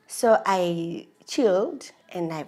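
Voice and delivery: ordinary talk, nothing marked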